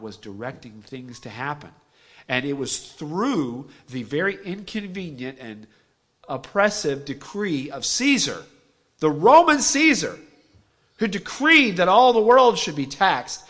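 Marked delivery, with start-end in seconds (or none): 2.3-13.4 rising, loud preacher's cry; preaching at full force. Acted. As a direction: build the sermon up to a loud, high peak.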